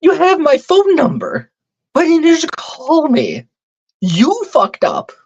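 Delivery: venting in real disbelief at the cops; worked-up rant. Real, not acted. Angry rant about the cops.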